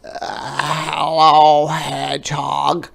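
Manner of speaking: loud croaky frog voice; croaking frog. A narrator's reading. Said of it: "croaked Frog".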